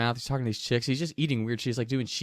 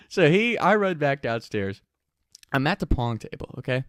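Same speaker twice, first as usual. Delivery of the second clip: ordinary delivery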